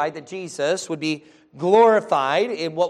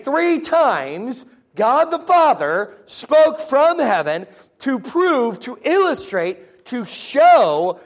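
slow, raised, projected pulpit voice; drawn-out, high-pitched emphasis; emphatic preaching; 6 semitones higher, 5 dB louder, slower than usual. Real, not acted. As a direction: hammer the point home phrase by phrase. Preacher drives home his main point.

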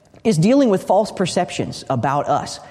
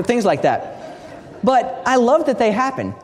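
usual speaking voice

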